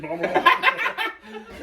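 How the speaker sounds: high, slow, laughing blah blah; mock muffled underwater babble; garbled submarine voice. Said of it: "like he's talking to submarine".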